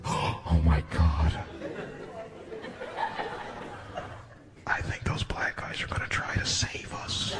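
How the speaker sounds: hushed, breathless hope. Retold or imitated. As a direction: whisper it in awe.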